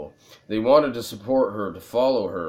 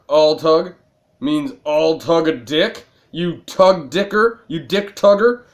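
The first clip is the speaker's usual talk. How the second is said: loud insulting rant, higher and louder; yelling at character; 6 semitones higher, 6 dB louder than usual. Real, not acted. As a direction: tell off the game character at full volume. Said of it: "I'm yelling at a".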